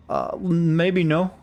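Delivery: slow, high, unsure drawl; drawn-out hesitation. Real, not acted. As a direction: stretch it out, wary and doubtful. Hesitant refusal after chat message.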